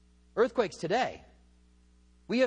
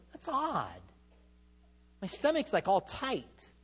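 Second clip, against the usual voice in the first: puzzled wife; 3 dB quieter, slower than usual. Retold or imitated. Wife's first contraction, quoted calmly.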